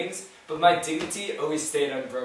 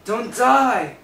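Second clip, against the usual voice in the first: panicked pleading; 3 semitones higher, 4 dB louder than usual. Acted. Pleading over a dying goat.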